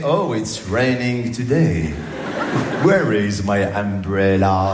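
very slow parody of English drill sentences; rote lesson phrases. Retold or imitated. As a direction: recite word by word, stretched out, mock English accent. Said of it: "des phrases débiles à répéter".

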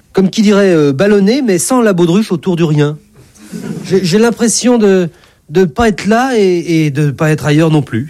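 high, halting self-description; fumbling patient explaining an odd symptom; hesitant patient. Acted. God haltingly describes his symptoms.

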